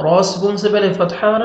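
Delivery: ordinary talk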